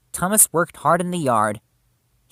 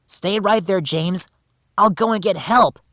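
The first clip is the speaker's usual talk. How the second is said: high, loud, resolute call; urgent calling out; 6 semitones higher, 6 dB louder than usual. A narrator's reading. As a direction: call back as you rush for help. Rescuer calls to crashed friend, leaving.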